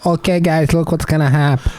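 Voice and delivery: put-on high-pitched joking Brazilian accent; put-on Brazilian English, high. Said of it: "talking like a Brazilian".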